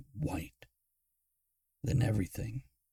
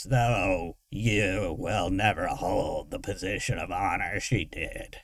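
louder, jittering machine voice; jittery voice; 4 dB louder than usual. Acted. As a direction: announce it like a broken creepy box. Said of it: "the jittery voice screeched".

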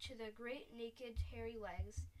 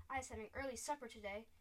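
usual speaking voice

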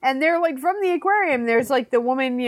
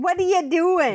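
high-pitched Boston accent imitation; Hoover; 8 semitones higher than usual. Co-host chiming in with the seal's Boston-accented phrase. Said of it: "had a thick Boston accent".

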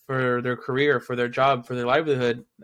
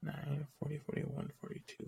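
counting under breath; 19 dB quieter than usual. Real, not acted. Counting episodes quietly to himself.

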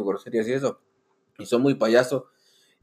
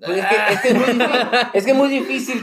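riendo; 6 semitones higher, 4 dB louder than usual. Real, not acted. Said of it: "presume riendo".

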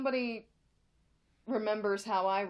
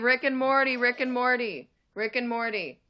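loud; 3 semitones higher, 8 dB louder than usual. Real, not acted. Shouting name for internet.

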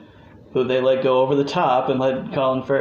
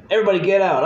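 raised, louder imitated order; mock police command; 8 semitones higher, 5 dB louder, much faster than usual. Fan imitating Jim Gordon clearing out the police, loud mock command. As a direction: call it out like clearing a room.

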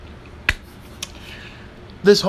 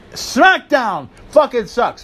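mocking rant; 6 semitones higher, 3 dB louder, slower than usual. Real, not acted. Ranting list of promotions.

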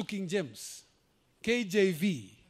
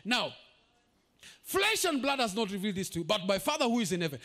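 normal talking voice

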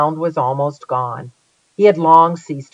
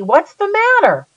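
holler; 13 semitones higher than usual. Acted. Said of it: "I hollered and asked Rainey".

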